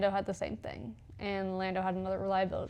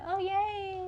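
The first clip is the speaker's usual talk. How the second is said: sarcastic; 10 semitones higher than usual. Retold or imitated. Sarcastic teammate quote, bored cheer.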